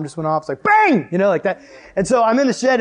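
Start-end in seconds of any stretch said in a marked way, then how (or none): 0.6-1.1 high, explosive shouted bang; sudden booming sound effect; loud bang imitation. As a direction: shout it like a bomb going off. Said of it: "It's really loud. It sounds like a nuclear bomb".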